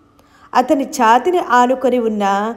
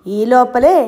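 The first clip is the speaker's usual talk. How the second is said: usual speaking voice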